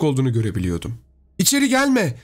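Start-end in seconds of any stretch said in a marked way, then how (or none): 1.4-2.2 high, urgent cry of warning; acted high yell, little added volume; high-pitched yell. A narrator's reading. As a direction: cry out high to keep someone away. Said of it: "diye bağırdı Samantha".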